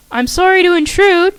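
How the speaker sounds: heroine's hesitant apology; voiced heroine, cautious. A narrator's reading. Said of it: "cautiously entering in".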